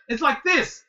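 upset teacher demonstrating. Retold or imitated. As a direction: show the student impatiently how it's done. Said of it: "So he got upset".

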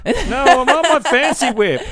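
high loud whine, laughing; yelling across the room. Pretend. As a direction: yell it high like a spoilt kid. Spoilt son yelling, laughing.

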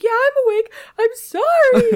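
loud, squeaky imitation of a half-awake husband; high wailing apology, hammed up; mock high-pitched voice. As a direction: protest in an exaggerated falsetto. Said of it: "really high-pitched voice".

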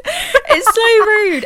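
squealing it out through laughter, slow; high, slow, cracking with laughter; laughing hard. Real, not acted. Laughing at Twilight scene.

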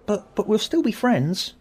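a boy insisting against the bad news; defiant. A narrator's reading. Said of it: "Tim said defiantly".

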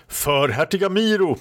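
high rallying call; battle cry. A narrator's reading. Knight rallies the duke's men, high battle cry. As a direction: cry out for your lord, pitch up. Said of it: "ropade herr Negel".

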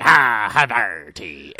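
slow, low pirate-captain drawl; SpongeBob pirate voice. Retold or imitated. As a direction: croon Havarti like the SpongeBob pirate. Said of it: "I turn into fucking the beginning of SpongeBob".

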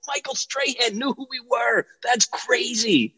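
high, softened voice of amazement; starstruck awe. Retold or imitated. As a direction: marvel at it, high and hushed, slowing at the end. Replays starstruck amazement afterwards.

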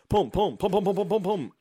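loud high rapid "pum" shots; imitated gunfire. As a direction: mimic shooting with your mouth, loud and high. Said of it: "he just goes".

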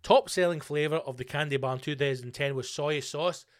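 ordinary talk

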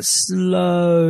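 words stretched right out; mock slow motion. Describing her sluggish toad in the race, mock slow motion. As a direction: drag it out like slowed-down film. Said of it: "it was just moving so slow".

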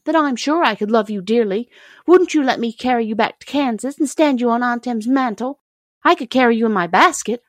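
a hint of a fond girl pleading; eager young girl. A narrator's reading.

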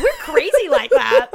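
laughing through the words; giggling it out. Real, not acted. Laughing banter.

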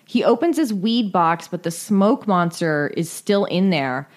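ordinary delivery

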